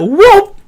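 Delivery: comic slide-whistle whoop swooping upward; little mock sound effect; rising whoop sound effect. Shown as a demonstration. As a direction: imitate a cartoon whoop, pitch sliding up. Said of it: "kind of little sound effect".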